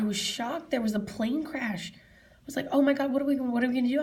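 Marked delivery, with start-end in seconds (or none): 2.7-4.0 higher, louder re-enacted panic; retold scared. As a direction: relive a mild panic, voice lifted. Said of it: "I was so scared".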